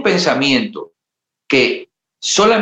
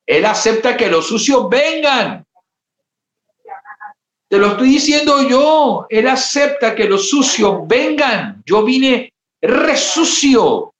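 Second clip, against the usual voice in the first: impassioned shout; 11 semitones higher, slower than usual. Acted. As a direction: cry it out to the sinner, high and strong. Preacher shouting the invitation.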